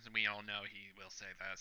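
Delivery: normal talking voice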